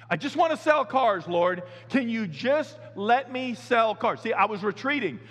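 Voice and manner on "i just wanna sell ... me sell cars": higher and louder, slow begging; pleading complaint. Retold or imitated. Pastor begging the Lord to let him sell cars, loud and slow. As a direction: plead with God to let you quit. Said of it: "I just told the Lord".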